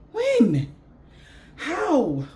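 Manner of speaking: squeaked in disbelief, high-pitched; shocked high when. Retold or imitated. Someone hearing the secret, high shocked squeak. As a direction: jump way up in shock.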